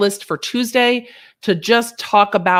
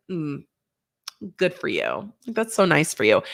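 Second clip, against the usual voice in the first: much lower, softer, dismissive drone; low sarcastic good for you; sarcastic backhanded; 5 semitones lower, 4 dB quieter than usual. Shown as a demonstration. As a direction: deliver it as a backhanded dig. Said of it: "always sarcastic and a backhanded".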